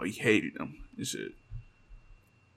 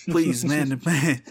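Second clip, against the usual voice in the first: mock pleading; 3 semitones higher, 8 dB louder, slower than usual. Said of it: "He says".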